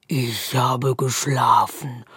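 low, slow grumbling growl; darkness growling. A narrator's reading. The darkness growls at the squirrel, slow and low. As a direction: grumble like a woken beast. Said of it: "knurrt die Dunkelheit".

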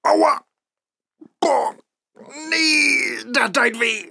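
high, loud pained cries; yelp of pain. Acted. Faust yelping in pain after the knee. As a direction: howl after the kick. Kneed by the woman.

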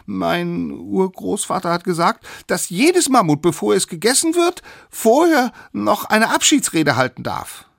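insistent little drumstick citing a rule; pleading little one. Acted. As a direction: argue for your life in a high pipe. Smallest pleads by citing great-grandfather.

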